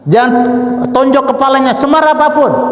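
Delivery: high, emphatic warning from the pulpit; khotbah berapi-api. Real, not acted. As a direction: warn the congregation in a high, forceful voice. (peringatan lantang bernada tinggi)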